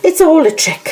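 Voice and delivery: put-on high child. Child declares.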